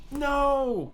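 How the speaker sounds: high, loud dismayed cry; shocked cry. Real, not acted. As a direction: cry out in disbelief. Shocked reaction to scene.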